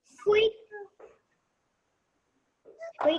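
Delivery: high little squeak; mouse voice. Acted. Child reading the mouse's word, high mouse voice. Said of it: "say it with your mouse voice".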